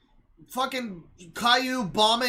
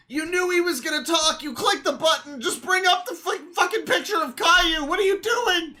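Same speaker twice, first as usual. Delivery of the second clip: higher, rising exasperated yell; 7 semitones higher than usual. Real, not acted. Viewer yelling at the clumsy creator, exasperated higher shouts. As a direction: shout at the creator through the screen.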